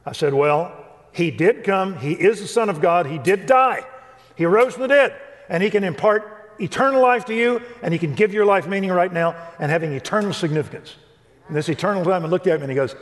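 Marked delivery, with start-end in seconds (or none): 0.3-11.0 high, loud, emphatic preaching; fervent witness. Retold or imitated. New believer telling his teammate about Jesus, loud and fervent. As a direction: pour out the good news with fire. Passionately sharing gospel with teammate.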